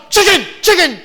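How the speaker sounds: high shouted chicken, chicken; high, loud childish shout; mock child shout. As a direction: yell it like an excited kid. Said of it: "And my son".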